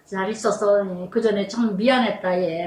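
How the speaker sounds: low, soft and gentle; hushed tender reconciliation; tender apology. Retold or imitated. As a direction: apologize softly to an old friend.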